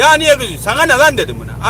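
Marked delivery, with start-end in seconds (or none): 0.0-0.6 the other driver, higher and louder, asking why you're angry; mock his indignant whine; imitating the other driver's raised complaint; retold driver protesting. 0.6-1.2 louder and higher curt retort; retold angry snap. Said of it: "Aynen böyle".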